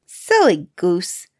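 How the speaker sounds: slow, odd crone voice; the witch mocking Gretel's question; scolding witch. A narrator's reading. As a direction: sneer the words out slowly. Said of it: "said the old woman".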